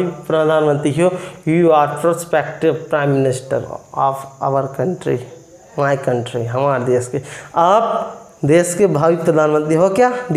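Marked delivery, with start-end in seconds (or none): 1.5-6.5 slow example. Shown as a demonstration. Teacher translating the example slowly to students. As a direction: lay the sentence down slowly and gently. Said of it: "आप हमारे देश के भावी".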